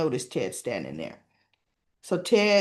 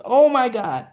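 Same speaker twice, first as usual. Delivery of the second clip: loud, raised exclamation; acted alarm; 4 semitones higher, 9 dB louder than usual. Nurse catching Derek as he almost falls, alarmed. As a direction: exclaim loudly in mock alarm.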